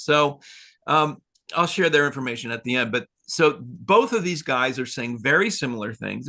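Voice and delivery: ordinary delivery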